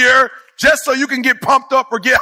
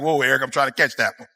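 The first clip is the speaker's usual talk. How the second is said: a hint of an overwhelmed listener, lower and softer; audience member; 12 semitones lower, 6 dB quieter than usual. Audience member to Eric: low, soft mock overwhelm. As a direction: lightly voice someone straining to keep up. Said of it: "I see some people like".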